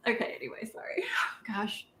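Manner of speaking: whispered, winded after a laughing fit; recovering from laughing. Real, not acted. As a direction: catch your breath after crying with laughter. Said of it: "Now I'm crying".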